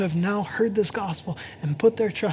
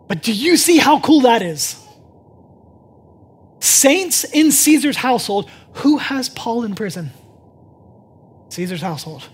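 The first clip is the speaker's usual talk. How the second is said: loud, rising sermon voice, slowing on key phrases; full-force preaching; 5 semitones higher, 9 dB louder than usual. Acted. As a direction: preach it out to the whole room.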